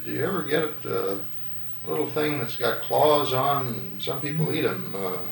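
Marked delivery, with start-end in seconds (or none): none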